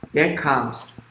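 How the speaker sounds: ordinary delivery, nothing marked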